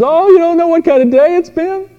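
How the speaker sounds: high, loud mock wife voice; upset wife. Wife unloading her bad day on husband, shrill mock wail. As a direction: squeal it like a frazzled wife.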